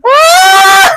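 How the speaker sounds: high, loud squeal of delight; delighted scream. Real, not acted. Vlogger thrilled by the colour, delighted scream.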